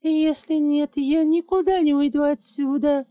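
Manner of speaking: put-on girl issuing an ultimatum to her father; stubborn child's threat; defiant little girl. A narrator's reading. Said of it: "выдала".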